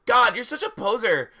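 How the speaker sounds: high, loud mock-indignant yell; hammed-up scornful shout. A narrator's reading. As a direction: shout it as a fed-up teen. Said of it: "I shouted at Hagrid".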